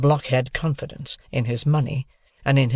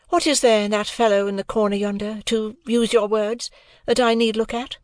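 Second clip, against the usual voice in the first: put-on higher, cool young lady's voice; a proud young woman answering lightly; Estella voice; 9 semitones higher than usual. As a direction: play Estella, poised and aloof.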